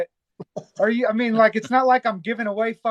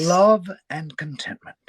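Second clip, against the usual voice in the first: slow, measured British accent; put-on British narrator, drawn out; slower than usual. Shown as a demonstration. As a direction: read the title out in a British accent. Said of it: "I did it in a British accent".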